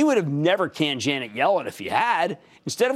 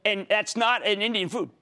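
normal talking voice

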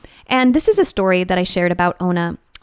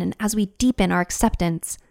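ordinary delivery

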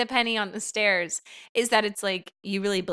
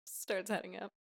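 quiet aside; 3 semitones lower, 17 dB quieter than usual. Acted. Stage direction, quiet aside.

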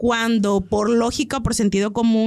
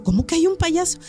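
higher pitched incredulous question; surprised; 7 semitones higher than usual. Retold or imitated. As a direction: ask it in disbelief, pitch up. Teacher surprised, second time.

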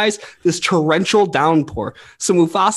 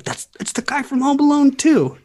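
put-on little kid self; 10 semitones higher than usual. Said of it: "I was like".

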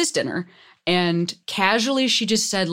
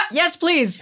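excited; 9 semitones higher than usual. Real, not acted. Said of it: "voice crack with excitement".

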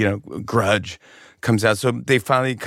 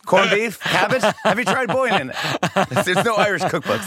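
mock Irish cooking; 8 semitones higher than usual.

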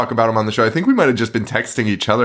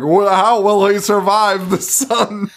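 mock dramatic narrator; 8 semitones higher, slower than usual. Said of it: "and it's like".

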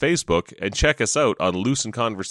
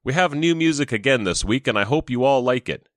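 ordinary delivery

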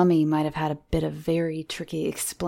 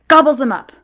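sudden high, loud burst; pouncing scare; 8 semitones higher, 13 dB louder, faster than usual. Acted. Johnny startles Miranda at the tale's climax, loud.

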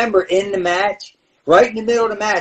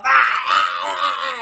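high, yelping cries; spring up with sharp yelps; hammed-up startled yelps; mock yell; 6 semitones higher, much slower than usual. Jumps up yelling at locker room.

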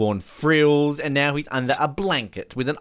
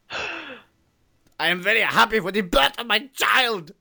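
put-on slow, high angry German accent; angry-sounding happy German; 8 semitones higher, slower than usual. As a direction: announce joy like a furious German. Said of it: "even a happy German sounds angry".

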